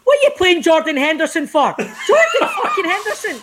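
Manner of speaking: outraged pal mocking the lineup; mocking mate. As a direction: ridicule the team pick. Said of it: "he's like".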